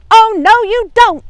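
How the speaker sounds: high, very loud yell. Acted. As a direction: yell it to stop him. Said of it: "yelled a familiar voice".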